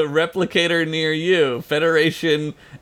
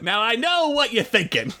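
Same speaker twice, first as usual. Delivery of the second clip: a touch of a higher, hammed-up salesman, laughing; Scoops McGee voice; 8 semitones higher, 3 dB quieter than usual. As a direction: keep the sales pitch going through a laugh. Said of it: "not Scoops McGee's voice".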